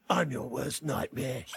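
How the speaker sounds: low, slow action-hero threat; mock tough-fighter growl; menacing Goldilocks. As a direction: deliver it deep and deadly. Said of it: "Goldilocks does this sort of".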